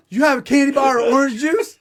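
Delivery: high, loud, laughing plea for candy or juice; panicked plea. Retold or imitated. Faking diabetic to the trainer, laughing high plea. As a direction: beg for sugar through a laugh. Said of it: "the one thing I remember is".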